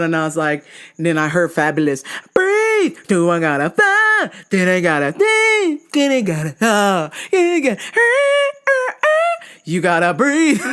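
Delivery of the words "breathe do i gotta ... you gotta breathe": higher, breathless and frantic; losing it while trying to calm down; frantic quote. Retold or imitated. Panicked inner song quote.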